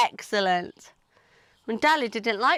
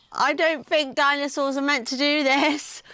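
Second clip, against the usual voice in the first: usual speaking voice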